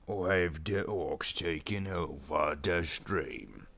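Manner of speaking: slow orc boss drawl. Pretend. Orc boss announces a stream takeover, slow orc voice. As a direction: draw it out as a boastful orc. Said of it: "doing this orc voice".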